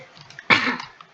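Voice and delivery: a real dry cough; coughing fit. Real, not acted. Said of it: "I will be coughing throughout the video".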